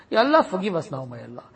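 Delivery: ordinary delivery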